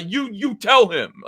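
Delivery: slow, haughty order to his son; commanding father. Retold or imitated. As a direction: hand the job off imperiously. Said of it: "he tells his son".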